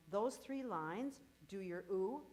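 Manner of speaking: ordinary delivery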